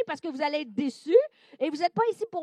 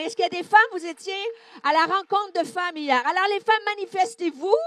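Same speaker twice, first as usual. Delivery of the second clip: calling out; 5 semitones higher, 6 dB louder, faster than usual. Real, not acted. Calls out to the women.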